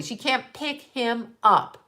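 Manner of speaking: "pick him up" spaced out word by word; slow right down to drive "can't pick him up" home; deliberate, separated words on "pick him up"; slow, emphatic repeat. Real, not acted.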